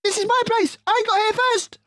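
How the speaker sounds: very high, loud rat shriek. Acted. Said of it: "shrieked Rat".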